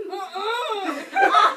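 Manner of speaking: loud, high mock-crying "uh-uh"; high, loud tearful protest, acted out; wailed refusal. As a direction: whine a big no, half laughing. Refusing to be lifted, retold.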